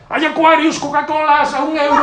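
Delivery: pregón de playa agudo y fuerte; vendedor gritando. Retold or imitated. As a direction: vocea como un vendedor ambulante en la playa. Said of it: "viene alguien y grita". (vendedor de playa pregonando bebidas a voz en grito)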